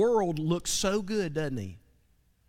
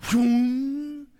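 a loud, higher whoosh for the clown popping back up; clown springing up; 7 semitones higher, 5 dB louder than usual. Retold or imitated. Preacher voicing the blow-up clown popping back, loud whoom. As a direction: make the sound of it bouncing back.

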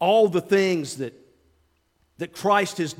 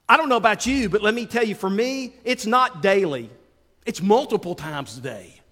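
ordinary talk, nothing marked

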